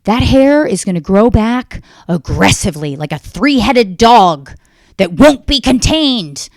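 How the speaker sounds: high, loud, slowed doom-laden mother; hammed-up mom warning of monstrous regrowth; mom's dramatic warning. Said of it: "she was like".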